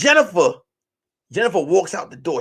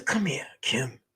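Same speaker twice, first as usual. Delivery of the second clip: low, soft near-whisper; acted intimate whisper; hushed coaxing; 6 semitones lower, 11 dB quieter than usual. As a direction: coax her closer under the breath. Coaxing her close, retold.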